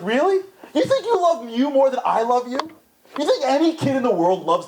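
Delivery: a touch of gentle disbelief; lightly voiced God asking back; incredulous God. God answers, first take.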